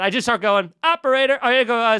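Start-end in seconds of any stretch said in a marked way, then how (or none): none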